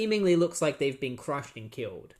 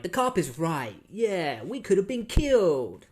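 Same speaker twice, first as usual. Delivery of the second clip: outcry building higher and louder; mock angry crowd; 5 semitones higher, 6 dB louder than usual. Mall citizens side with the cop, mock outrage. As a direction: imitate a riled mob, rising to a shout.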